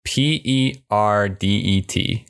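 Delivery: P E R D E T, letter by letter, slowly; spelling it out. Shown as a demonstration. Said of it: "can you spell that, please".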